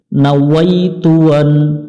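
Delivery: slow, careful Arabic recitation of নাওয়াইতু আন; drawn-out নাওয়াইতু আন with the w sound clear; correct Arabic. Shown as a demonstration. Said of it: "আমরা এখানে পড়ব".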